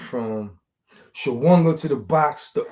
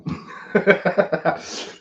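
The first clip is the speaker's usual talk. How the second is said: breathy laugh; 8 semitones higher than usual. Real, not acted. Laughing at nickname.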